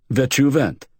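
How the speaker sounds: high, hammed-up fake Turkish accent; a ham actor's put-on Turkish. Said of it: "I've never heard a Turkish accent like that".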